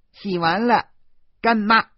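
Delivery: put-on loud, high childlike shout; little girl. A narrator's reading. Little devil stubbornly answering godmother, high shout. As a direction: answer crisply at the top of the voice.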